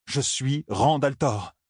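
slow falling insistence on "Rand"; desperate mantra. A narrator's reading. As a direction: drag out "Je suis Rand al'Thor" like an anchor. Repeated mantra, even slower.

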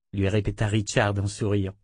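usual speaking voice

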